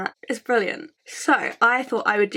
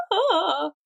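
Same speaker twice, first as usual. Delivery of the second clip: hoarse croak from a cold; rough sick-voice croak; croaky voice; 8 semitones higher than usual. Shown as a demonstration. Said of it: "My voice is very like".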